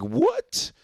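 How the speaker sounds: high, stunned squeak of disbelief; shocked what. Retold or imitated. Host retelling his shock at the couple, squeaking what. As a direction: gasp it out in total disbelief. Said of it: "I was like".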